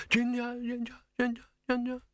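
fading echo. Acted. Victor's call echoing back through the caverns, fading echo. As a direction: let the name echo off into distant corridors. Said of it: "bouncing away along caverns and corridors".

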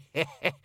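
high cackling laugh; old woman cackle. Acted. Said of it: "a cackle".

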